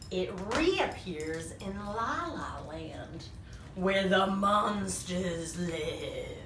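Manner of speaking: very slow, drawn-out reveal; ominous storytelling. A narrator's reading. Narrator revealing where the monsters live, slow and ominous. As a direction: stretch the words out for suspense.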